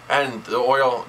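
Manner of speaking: ordinary talk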